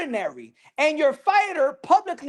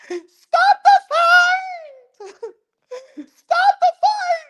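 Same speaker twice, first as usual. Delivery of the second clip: shrill hammed-up scream, much higher and louder, slow; mock screaming wife; 6 dB louder, much slower than usual. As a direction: scream it like a panicked wife ringside. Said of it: "screaming like Apollo Creed's wife".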